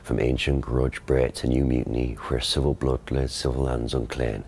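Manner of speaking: Shakespearean English accent, rhotic R; quiet Elizabethan original pronunciation reading. Shown as a demonstration. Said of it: "You do that one?".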